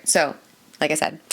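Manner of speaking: usual speaking voice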